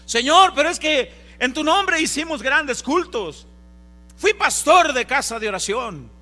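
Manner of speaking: loud, high pleading cry; desperate plea. Acted. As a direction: beg the Lord at full voice, high and loud. Fieles suplicando al Señor. (súplica desesperada a gritos)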